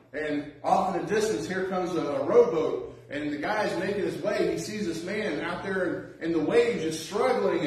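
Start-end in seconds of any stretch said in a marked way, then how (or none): none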